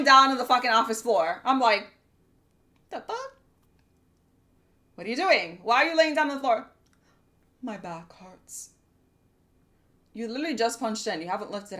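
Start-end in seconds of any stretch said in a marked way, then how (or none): 2.9-6.7 high, startled "the fuck?"; mock outrage at a lazy coworker; shocked disbelief. Retold outburst at coworker. 7.6-8.7 soft, slow mock whine; lazy coworker mumbling an excuse, softer and slower; whiny coworker. Coworker voiced whining.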